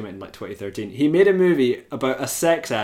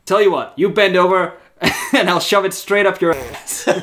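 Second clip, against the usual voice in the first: higher, louder, breaking into laughter; laughing retort; 7 semitones higher, 7 dB louder than usual. Retold or imitated. Bartender's comeback to the bully, retold through laughter. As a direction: act the comeback while cracking up.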